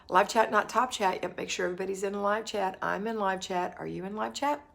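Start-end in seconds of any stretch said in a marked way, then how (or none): none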